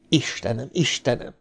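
soft, appalled murmur; hushed horror. A narrator's reading. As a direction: say it under the breath, sickened. Ben's sickened thought.

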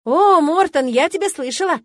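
light up as if meeting someone famous; joyful. A narrator's reading. Eren greets Gwendolyn by name, loud and delighted. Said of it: "радостно заявила Эрен".

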